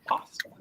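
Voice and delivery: "Pasta" said softly with an open ah; ah pronunciation. Shown as a demonstration. Friend explaining the small A, soft "Pasta" with an ah. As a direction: softly say "Pasta" as "pah-stuh". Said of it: "It's a small A".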